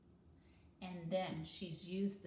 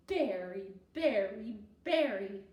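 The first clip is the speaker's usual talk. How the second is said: weary sigh; 9 dB louder than usual. Acted. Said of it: "Gooney Bird said with a sigh".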